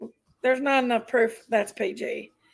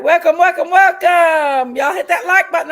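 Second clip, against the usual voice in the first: loud welcome; 4 semitones higher, 8 dB louder than usual. Real, not acted. Host welcoming stream viewers.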